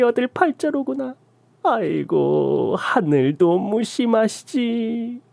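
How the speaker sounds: slow, keening lament over her fate; wailing shaman. Acted. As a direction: mourn her fate like a dirge. Shaman wails pity at narrator.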